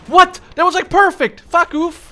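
very high, loud overjoyed scream; ecstatic yell. Real, not acted. Player screaming with joy after a perfect mortar hit. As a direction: shriek with delight at the shot.

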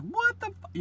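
very high squeak of shock, broken off mid-word; cut-off shocked cry. Retold or imitated. Witness seeing the knife, squealing in cut-off shock. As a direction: blurt it out and choke it off. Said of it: "he's like".

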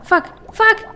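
sharp high yelp of a curse; startled curse. Real, not acted. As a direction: blurt it in shock. Attacked again.

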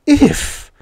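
outburst. A narrator's reading. Miss Mule interrupting at the word innocent, bursting out. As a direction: burst out high in pitch. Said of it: "Miss Mule burst out".

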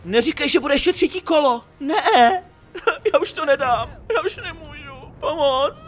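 high, laughing mock sobs of exhaustion; laugh-cry at the endless fight; real laughing exasperation, high; laughing despair. Real, not acted.

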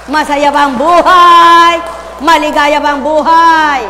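loud rising preacher's call; preaching at full force. Real, not acted. Preacher booming questions at the congregation.

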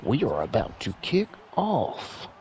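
booming announcer opening the game; stadium announcer. Acted. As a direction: announce the kickoff like a stadium host.

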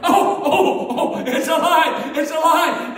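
high, loud, amazed carnival barker; hammed-up astonished street performer; Arthur Harris's showman act. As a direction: gasp and marvel at the hat to draw a crowd. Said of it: "And he'd look under there again".

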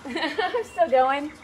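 laughing through the words, slowed right down. Real, not acted. Painter answering a viewer's question, laughing. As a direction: chuckle it out to the chat, amused.

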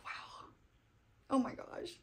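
whispered oh my gosh, overcome; barely voiced, emotion catching the breath; a real hushed gasp of feeling; breathless whisper. Real, not acted. Overwhelmed by a moving book.

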